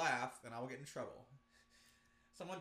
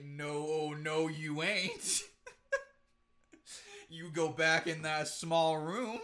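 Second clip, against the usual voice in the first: loud, slow laughing scold; trying not to laugh; 4 semitones higher, 11 dB louder, much slower than usual. Retold or imitated. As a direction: scold through giggles. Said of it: "be like".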